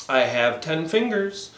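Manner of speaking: ordinary delivery, nothing marked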